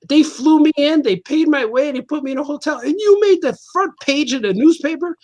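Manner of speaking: high-pitched, indignant retelling; mock outrage. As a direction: act out a put-out guest's disbelief. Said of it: "He was so mad".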